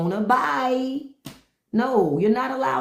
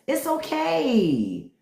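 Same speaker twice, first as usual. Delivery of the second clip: mock comforting; 5 semitones higher, much slower than usual. Real, not acted. Mock comforting jealous men.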